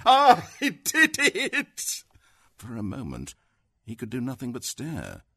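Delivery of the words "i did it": high, slow, laughing shriek; crazed laughing. A narrator's reading. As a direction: laugh it out loud, almost hysterically. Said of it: "She laughed almost crazily".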